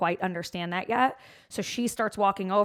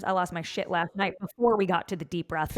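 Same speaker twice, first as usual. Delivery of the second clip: ordinary talk, nothing marked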